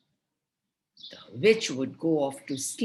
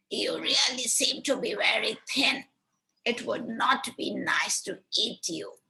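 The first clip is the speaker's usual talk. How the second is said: higher witch voice judging Hansel too thin to eat; 6 semitones higher than usual. Acted. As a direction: savour the thought like a hungry hag. Witch feels Hansel's hand.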